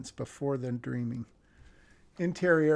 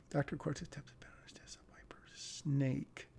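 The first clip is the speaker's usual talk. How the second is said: quick soft whisper; whispered read; 10 dB quieter than usual. Acted. Reviewer whispers the script line while reading it. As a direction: murmur the line to yourself.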